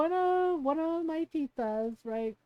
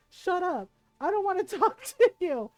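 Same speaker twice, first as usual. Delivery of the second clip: higher, laughing imitation of irritation; mock annoyance; 4 semitones higher, 4 dB louder than usual. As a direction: brush off the teasing, half laughing. Beaten cousin snaps at teasing.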